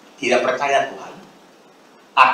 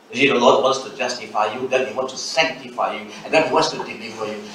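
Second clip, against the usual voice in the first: low-pitched, preachy recitation; mock church jargon; 9 semitones lower, 3 dB quieter than usual. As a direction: drone it like a jargon-heavy preacher. Parodies technical church language.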